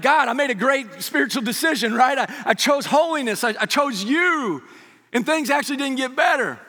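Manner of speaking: high, loud plea to God; hammed-up complaint, drawing out the last words; aggrieved protest. Believer complains to God as life falls apart, aggrieved protest.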